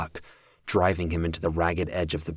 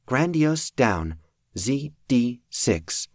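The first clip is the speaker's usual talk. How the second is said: flat robotic announcement; slow machine readout; mechanical voice; 7 semitones higher, much slower than usual. Acted. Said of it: "a mechanical voice blared from the armband".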